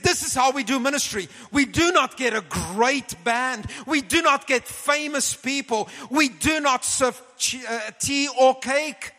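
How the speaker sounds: high, loud imitation of a fervent preacher; preaching voice. Retelling Reinhard's preaching to him.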